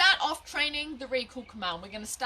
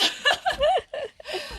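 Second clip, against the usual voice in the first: high laugh; 7 dB louder than usual. Real, not acted. Laughing at her slip.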